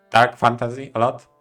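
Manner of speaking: normal talking voice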